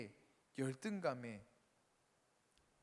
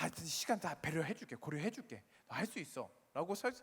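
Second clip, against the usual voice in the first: usual speaking voice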